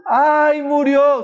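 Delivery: mournful wail. Retold or imitated. Mourners at a funeral crying out in grief. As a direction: wail like mourners over the dead. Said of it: "la gente decía".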